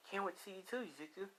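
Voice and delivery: put-on high, soft girlish affection; sweetheart's soft, high voice; Lisa. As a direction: speak tenderly, high and quiet. Lisa fondly answers boyfriend.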